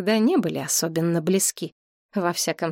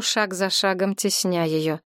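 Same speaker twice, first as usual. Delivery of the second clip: ordinary delivery, nothing marked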